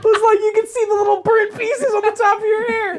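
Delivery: high, squealing voice through laughter; cracking up. Real, not acted. Teasing a friend about singed hair, squealing with laughter.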